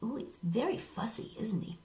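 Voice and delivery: usual speaking voice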